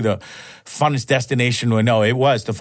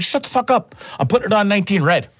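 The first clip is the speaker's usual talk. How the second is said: much higher, fast mock snap; imitating buddy's brush-off; 7 semitones higher than usual. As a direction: brush him off like a stubborn gambler. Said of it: "He's like".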